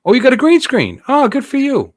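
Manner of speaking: high, sing-song mock praise; mock impressed. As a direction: tease him with fake admiration. Mocking reply to chat.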